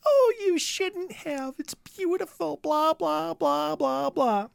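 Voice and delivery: high, soft mock gush over a gift; gushing actress voice. Actress gushing over the boyfriend's locket, high and soft. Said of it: "she's like".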